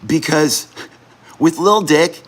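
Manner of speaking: high, keyed-up infomercial pitch; sales pitch. Acted. Streamer pitching his gift idea to viewers, hyped salesman voice. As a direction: sell the little dick gift like a TV ad.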